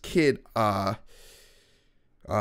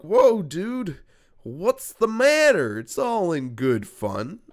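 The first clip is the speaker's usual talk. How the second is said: 1 surprised; 5 semitones higher, 4 dB louder, slower than usual. Retold or imitated. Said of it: "So we all look".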